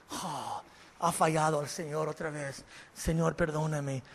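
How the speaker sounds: sorrowful confession, low and soft, lightly acted; mock tearful repentance. Believer weeping over failing God.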